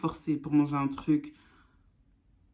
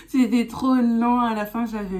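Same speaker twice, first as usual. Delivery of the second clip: laughing; 7 semitones higher, 7 dB louder than usual. Real, not acted. Laughing about long appointment.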